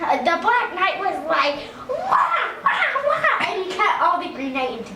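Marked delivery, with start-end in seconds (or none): 1.9-3.4 high cartoon fighting yelps; high "wah" with each sword swing; imitated knight's attack noises; mock battle cries.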